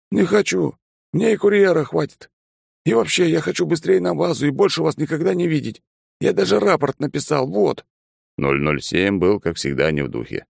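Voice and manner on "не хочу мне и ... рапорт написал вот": put-on high, whiny young pilot; sulky timid flyer, pleading to leave; nervous pilot voice. Pilot Bond's voice.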